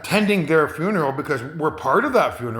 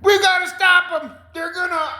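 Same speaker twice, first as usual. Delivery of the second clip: much higher, urgent mock panic; alarmed high voice. Man warning others about aliens, in a high mock-panicked voice. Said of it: "He goes".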